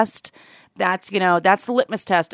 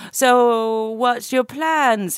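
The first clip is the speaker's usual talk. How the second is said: drawn-out mock Australian accent; 2 semitones higher, much slower than usual. Imitating an Australian TV interviewer, slow mock Australian accent. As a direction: stretch it into an Australian interviewer's question. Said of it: "that's my Australian accent".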